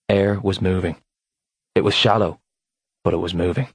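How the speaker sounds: ordinary delivery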